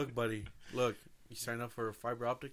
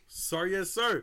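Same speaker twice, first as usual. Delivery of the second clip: loud gung-ho soldier; 5 semitones higher, 4 dB louder, much slower than usual. Pretend. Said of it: "our newest construction worker".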